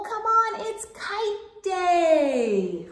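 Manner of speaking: high, loud, stretched slow; sing-song invitation to play; calling out. Acted. Bear urging Mole on kite day.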